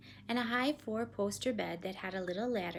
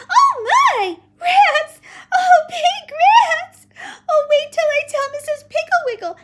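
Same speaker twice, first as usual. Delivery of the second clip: put-on very high, loud child's scream; panicked shriek; 20 dB louder, slower than usual. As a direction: shriek like a terrified little girl. Child panics at supposed rats.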